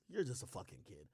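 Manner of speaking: low, soft acted shrug-off; resigned dismissal. Uncle excusing the nephew who hit him, resigned. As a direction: let it go, quietly and low.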